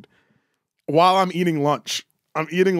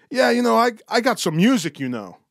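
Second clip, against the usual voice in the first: higher-pitched old Portuguese man impression; old Portuguese accent; 6 semitones higher than usual. Old Portuguese customer pitching his music, accented. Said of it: "is this an old Portuguese dude".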